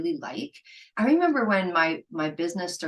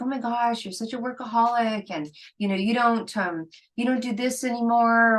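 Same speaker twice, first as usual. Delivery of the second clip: friends complaining; 5 semitones higher, 4 dB louder than usual. Retold or imitated. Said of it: "people were like".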